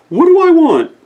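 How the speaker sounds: put-on very high, dreamy old owl voice; a forgetful owl thinking aloud; Oscar Owl voice. Oscar Owl's voice.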